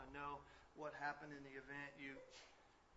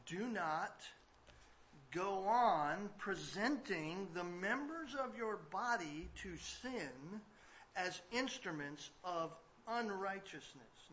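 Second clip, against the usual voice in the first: reading scripture; 4 semitones higher, 9 dB louder, much slower than usual. Acted. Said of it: "he says".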